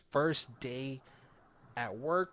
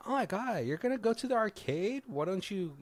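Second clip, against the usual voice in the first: higher, mock girlish excitement; excited girls; 8 semitones higher than usual. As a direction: imitate girls teasing a guy. Voices excited girls in retelling.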